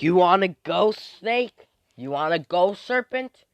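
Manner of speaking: loud, high, rising challenge; fighting challenge. Acted. Two rivals squaring up, loud mock-aggressive challenge. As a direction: dare them to fight, ending high.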